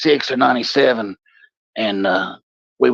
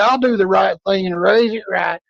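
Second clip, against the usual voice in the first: drunk fake confession; 9 semitones higher than usual.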